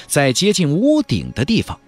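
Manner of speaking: ordinary delivery, nothing marked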